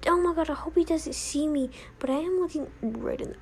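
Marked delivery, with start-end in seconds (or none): none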